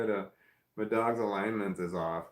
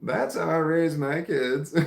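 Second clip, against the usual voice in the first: laughing; 6 semitones higher, 6 dB louder than usual. Real, not acted. Laughing at own story.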